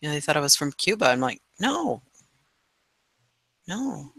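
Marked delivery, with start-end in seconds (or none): 1.6-4.1 high, soft retold "no"; a drawn-out, higher, quieter no; mock-puzzled soft denial, pitched up. Retold answer to mistaken origin.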